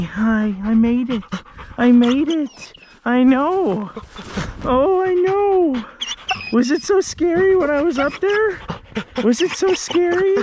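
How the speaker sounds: high, sweet voice for a pet; baby talk to dog. Acted. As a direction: coo at the dog in a squeaky voice.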